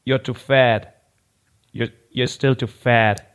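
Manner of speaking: low, mocking mirror voice; deadpan judging machine. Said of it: "der Spiegel sagt die ganze Zeit nur".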